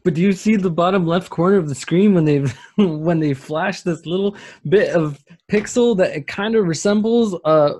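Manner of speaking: high, loud, breathless conspiracy-theorist excitement; mock excited. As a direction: gush like a fan spotting clues. Mocking fans' conspiracy theories.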